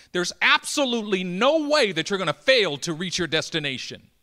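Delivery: loud, high preacher's climax; preaching at full force. Acted. Preacher proclaiming to the congregation, loud climax. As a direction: proclaim it to the whole church.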